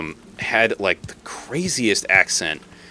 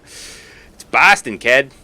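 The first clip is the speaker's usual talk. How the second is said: loud, higher, drawn-out Boston accent; 5 semitones higher, 6 dB louder, much slower than usual. Shown as a demonstration. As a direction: shout it like a proud Bostonian. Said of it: "I can't do Boston right now".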